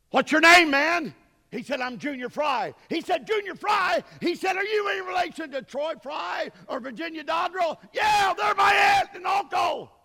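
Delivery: high, full-force preacher's shout; shouted exchange. Retold or imitated. Preacher retelling Brother Hand meeting Junior Fry, shouted exchange. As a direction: holler both sides of the conversation.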